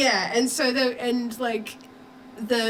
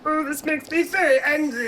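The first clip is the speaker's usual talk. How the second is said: Marvin the Martian voice; cartoon Martian voice; 4 semitones higher than usual. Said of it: "Marvin the Martian".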